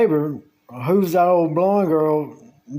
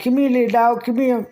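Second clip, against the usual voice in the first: calling the dog; 6 semitones higher than usual. Retold or imitated. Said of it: "I was like".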